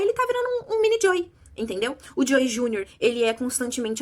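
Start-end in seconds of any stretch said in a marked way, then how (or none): 0.0-1.3 outraged exclamation. Real, not acted. Outraged at the little son.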